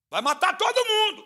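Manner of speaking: high, loud alarmed call; panicked warning. Retold or imitated. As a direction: warn him in a rush of fear. Fleeing people warn Samar.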